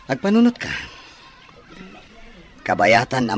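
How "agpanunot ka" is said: pressing command. Acted. Rich man pressing his offer.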